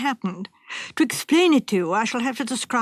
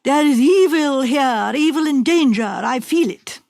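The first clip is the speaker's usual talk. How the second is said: fortune teller's warning; 3 semitones higher, 5 dB louder than usual. Retold or imitated. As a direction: intone it like a dark prophecy. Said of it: "she came in at that door and she said".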